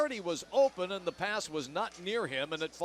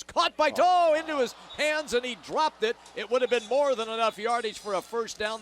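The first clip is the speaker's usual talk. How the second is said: excited; 6 semitones higher, 6 dB louder than usual. Real, not acted. Play-by-play on dropped pass.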